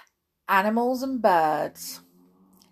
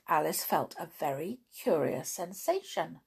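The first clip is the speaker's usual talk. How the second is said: usual speaking voice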